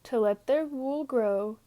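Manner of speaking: ordinary delivery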